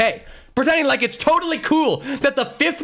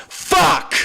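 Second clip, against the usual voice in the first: angry curse; 5 semitones higher than usual. Real, not acted. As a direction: spit it out in frustration. End of the rant.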